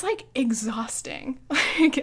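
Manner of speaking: usual speaking voice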